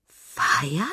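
breathy questioning hare; hare, incredulous. A narrator's reading. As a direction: ask it on a breath, hopeful. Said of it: "fragte der Hase". (gehaucht fragender Hase)

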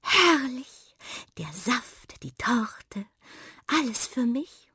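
breathless, delighted whisper. A narrator's reading. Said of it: "jubelte Miranda begeistert".

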